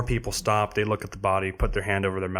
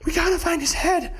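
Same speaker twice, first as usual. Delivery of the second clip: high, louder mock of the searchers; 4 dB louder than usual. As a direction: squeak it high mocking them. Mocking the searchers.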